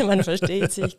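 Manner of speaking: laughing while repeating the host's words. Real, not acted. Guest laughing along at the host's stinginess joke, laughing. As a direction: echo the joke through real laughter.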